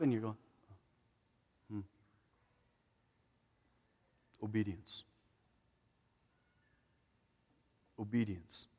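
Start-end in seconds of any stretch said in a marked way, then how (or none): 1.7-8.7 quiet realization. Pretend. Preacher voicing a listener realizing the call, soft and low. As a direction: let the word sink in softly.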